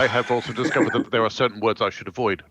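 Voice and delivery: put-on relaxed South African accent. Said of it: "your South African accent".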